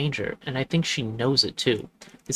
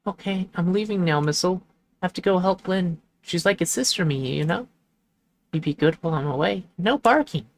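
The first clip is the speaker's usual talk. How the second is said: a touch higher, brisk voice for the owner leaving; lightly voiced game character; 7 semitones higher than usual. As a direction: read the leaving owner's lines a little lifted. Reads game character lines.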